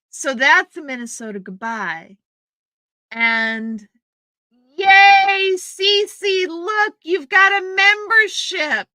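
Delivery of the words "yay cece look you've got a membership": high, loud, delighted cheer for a new member; light up and cheer her name; excited greeting. Real, not acted. Streamer cheering a viewer's new membership, excited.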